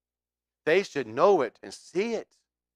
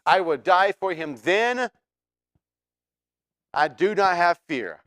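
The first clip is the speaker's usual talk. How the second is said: loud, higher, hammed-up declaration of faith; mock eager vow; 5 semitones higher, 7 dB louder than usual. Preacher mocks what our mouths say, loudly insisting on faith. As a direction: overeager promise, a bit hammed up. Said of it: "we want to say".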